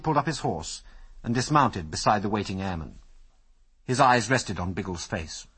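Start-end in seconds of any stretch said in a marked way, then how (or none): none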